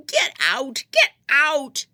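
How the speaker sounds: very high, very loud screamed order; screaming. A narrator's reading. Prisoner screams at the girl to leave, screaming. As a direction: scream them out of the house. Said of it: "her laugh turned to screams".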